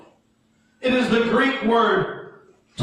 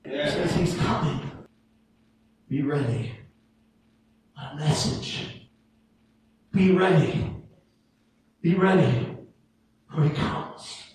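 much lower and softer, near whisper; hushed solemn; 9 semitones lower, 5 dB quieter than usual. Real, not acted. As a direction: bring it down to a hush. Preacher drops low and hushed.

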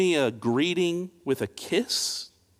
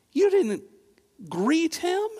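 much higher, stunned; incredulous; 12 semitones higher than usual. Pretend. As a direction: ask it as if you can't believe it. Incredulous questioning of Simon's neglect.

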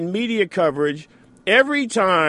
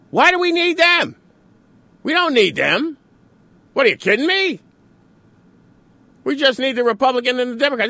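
mock outrage; 6 semitones higher than usual. Pundit scoffing at third-party candidates, mock outrage. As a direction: ridicule the idea like a dismissive pundit. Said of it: "people go, they go absolutely berserk".

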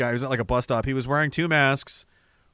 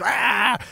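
short high mock shriek; mock goblin scream; 7 semitones higher than usual. Imitating goblins for chat, shrieking. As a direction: squawk like a goblin. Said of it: "They're like".

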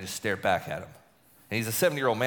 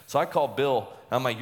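ordinary talk, nothing marked

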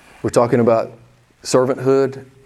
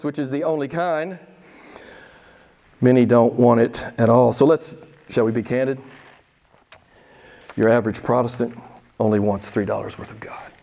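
ordinary delivery

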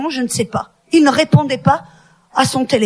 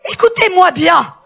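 rally the women like a preacher; fervent preaching; 3 semitones higher than usual. Acted. Exhorts the women, full preaching.

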